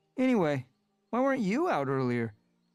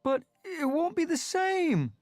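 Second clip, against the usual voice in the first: wailing; 8 dB louder than usual. A narrator's reading. Smudge grieving that Rusty will leave, high wail. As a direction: cry it out as if losing a friend. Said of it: "Smudge wailed".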